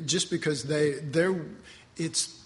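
ordinary talk

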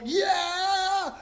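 high, drawn-out imitation cheer; mock crowd cheer. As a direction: imitate everybody cheering on one long high note.

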